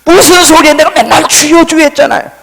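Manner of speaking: loud, high-pitched retort; acted outburst of objection; indignant protest. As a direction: protest hotly, voice shot up. Retold indignant protest back to God.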